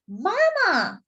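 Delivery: high, indignant call of Mama; crying out. Acted. Jessie protesting to Mama about Casey, high indignant call. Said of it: "Jessie cried".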